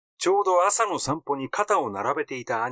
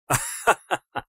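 a short knowing chuckle; light chuckle; 4 semitones higher than usual. Acted. Brother chuckles after explaining he let stepmother go, light chuckle.

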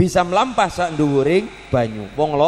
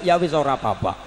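ordinary talk

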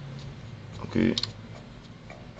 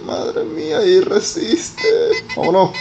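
excited high cheering; 3 dB louder than usual. Real, not acted. Urging car across bridge.

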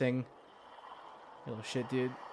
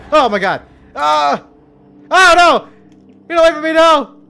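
shrieking higher and louder in panic; panicked screams; 13 semitones higher, 17 dB louder than usual. Real, not acted. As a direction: scream as something drags you under. Dragged down by creature, panicking.

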